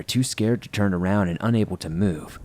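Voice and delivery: ordinary delivery, nothing marked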